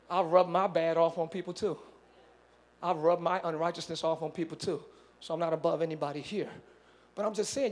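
low, hushed reassurance to the congregation; confiding aside. Real, not acted. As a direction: bring it right down, soft and low.

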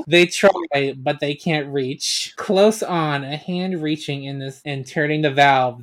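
normal talking voice

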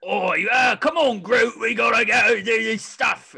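loud, high Cockney bragging; braggadocious Cockney. Retold or imitated. Fan voicing Rocket rallying Groot, loud Cockney swagger. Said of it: "a braggadocious".

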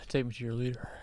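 unvoiced whisper of the alien line. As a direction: breathe it like a secret.

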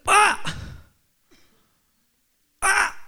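short, high, loud "ah"; startled yell. Retold or imitated. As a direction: yelp as the car hits. Car suddenly hits sand pit.

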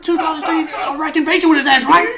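high, loud sarcastic tirade; hammed-up yelling accusation; mock sergeant rant. Sergeant to over-familiar recruit, mock rant.

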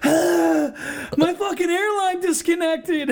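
high, loud, laughing mock panic; imitating himself panicked. Retelling ripping off his mask, high laughing mock panic. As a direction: replay his own frantic excuse. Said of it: "and I'm like".

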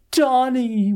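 high-pitched imitated call after someone. Willow calls out after them, imitated high call. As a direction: call out after people walking off, pitch leaping up. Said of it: "Willow calls out after them".

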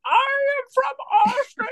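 very high, slow, drawn-out mock wail; Chris Hemsworth. Podcaster mocking Hemsworth's accent to co-host, high drawn-out caricature. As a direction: squeal it out slowly as a ridiculous accent parody. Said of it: "comes out and he's like".

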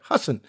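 HASSAN as "HUSS-n"; no second vowel. Shown as a demonstration. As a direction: say Hassan as huh plus a vowelless S-N. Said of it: "S N with no vowels there".

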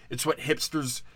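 ordinary delivery

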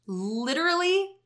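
"literally" jumps high and stretched; emphatic high. Real, not acted. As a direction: lift "literally" way up in pitch. Repeats word for emphasis.

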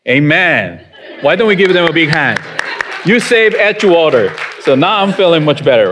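ordinary talk

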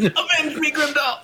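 high hammy dying cry; overacted death scene. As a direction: cry out like a fallen hero, over the top.

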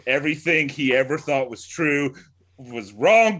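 normal talking voice

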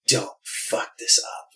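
slow whisper to yourself; whispered self-warning. Retold or imitated. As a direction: whisper it under your breath, slowly. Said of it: "I was like".

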